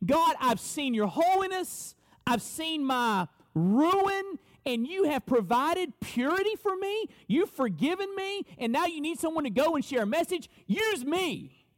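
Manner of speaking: high, earnest, pleading offer to God; eager volunteer. Acted. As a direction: beg to be sent, full of zeal. Isaiah voiced volunteering to God.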